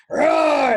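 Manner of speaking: loud high roar; lion's roar. Acted. Storyteller brings on the huge lion, sudden loud roar.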